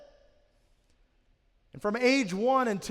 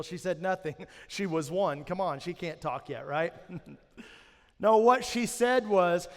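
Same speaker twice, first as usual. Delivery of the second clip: usual speaking voice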